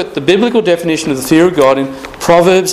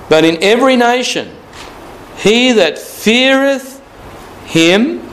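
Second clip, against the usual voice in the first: scripture reading; 8 semitones higher than usual. Acted. Preacher reading scripture aloud.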